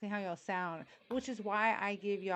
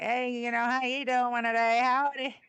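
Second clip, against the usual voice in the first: loud, higher cartoon-Texan greeting; mock Texas twang; 4 semitones higher, 8 dB louder than usual. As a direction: ham up a folksy Texas twang. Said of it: "we do have a little Texas twang".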